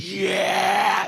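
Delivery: high loud fan's yell; cheering shout. Pretend. Irish fans cheering at a cup stacking tournament, high loud shout. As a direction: roar like a crowd at a tournament. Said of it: "be like".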